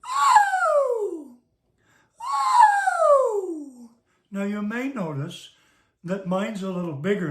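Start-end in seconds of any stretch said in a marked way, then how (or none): 0.1-1.4 tiny high bird-like cry; quail voice. Shown as a demonstration. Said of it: "sound like a little bird". 2.2-3.9 tiny high bird-like cry; quail voice. Shown as a demonstration. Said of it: "sound like a little bird".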